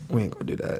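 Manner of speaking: whispered under the breath. Shown as a demonstration.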